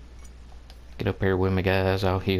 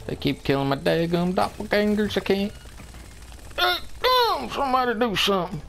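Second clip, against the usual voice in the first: higher, whiny complaint; frustrated whine; 10 semitones higher, faster than usual. Real, not acted. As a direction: whine helplessly for someone to act. Clones keep dying, begging for help.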